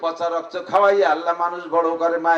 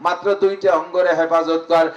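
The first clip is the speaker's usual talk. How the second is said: ordinary talk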